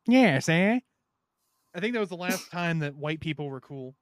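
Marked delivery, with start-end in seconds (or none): 0.1-0.8 put-on a light mid-Atlantic accent, higher and louder; a quick old-movie mid-Atlantic accent. Imitating the old mafia mid-Atlantic accent, lightly. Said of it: "North Atlantic accent".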